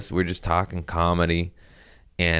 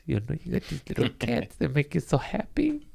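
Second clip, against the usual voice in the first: cooing; 9 semitones higher, 6 dB quieter than usual. Real, not acted. Cooing over cats.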